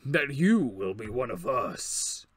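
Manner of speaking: low, slow demon voice; menacing and slow like a demon; ominous demon. Acted.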